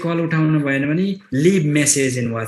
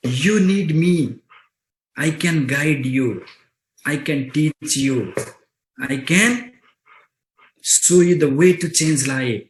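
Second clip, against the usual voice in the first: slow confident pitch; much slower than usual. Retold or imitated. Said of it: "leader की तरह जाइएगा".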